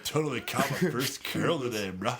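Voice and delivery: laughing hippie voice. Retold or imitated. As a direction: stoner hippie, cracking up. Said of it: "that's why I got the hippie voice".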